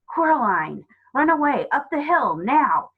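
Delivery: loud, high urgent command; tight, urgent. A narrator's reading. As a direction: order a child to flee at once. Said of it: "in a tight sort of way, urgently".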